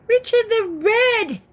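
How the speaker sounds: high, loud herald's proclamation; mock-grand announcement. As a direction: announce the guest like playful royalty.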